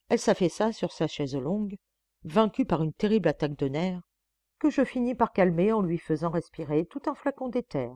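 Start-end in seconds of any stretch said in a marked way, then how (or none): none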